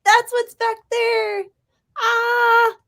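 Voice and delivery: very high, loud excited cooing; delighted squeal. Real, not acted. Streamer realizing a cat is back there, delighted squeal.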